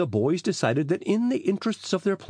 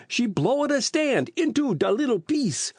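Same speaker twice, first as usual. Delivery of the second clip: put-on high, panicky Italian-accented plea; wailing; 9 semitones higher than usual. A narrator's reading. Rocco warns the police about his stand, high wail. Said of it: "he wailed".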